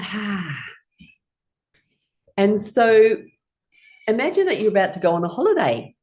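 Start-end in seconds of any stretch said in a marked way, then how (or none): 0.0-0.6 soft sighing "ha"; empty the lungs on a soft sigh; long gentle exhale; breathing out. Shown as a demonstration. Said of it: "breathing all the way out".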